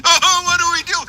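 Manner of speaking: high, whiny mock sob of a scared pundit; mock crying Democrat. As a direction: whimper it in a high, pathetic voice. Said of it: "keep crying in a corner and go".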